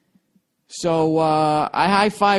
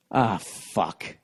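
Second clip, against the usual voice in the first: disappointed; 7 semitones lower, 6 dB quieter than usual. Retold or imitated. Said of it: "and he goes".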